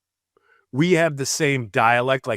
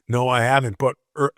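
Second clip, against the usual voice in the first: usual speaking voice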